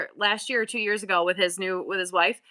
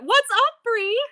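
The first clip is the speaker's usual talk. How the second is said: high excited greeting; 13 semitones higher, 5 dB louder, slower than usual. Real, not acted. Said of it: "greets a chat viewer".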